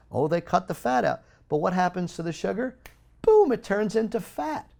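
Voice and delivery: impassioned outcry; heated rant. Real, not acted. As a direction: rail against the food industry, voice climbing. Ranting about sugar in low-fat foods.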